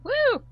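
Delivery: high little whoop; short, high cheer; cheering woo. Real, not acted. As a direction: give a high-pitched celebratory woo. Cheering at own introduction.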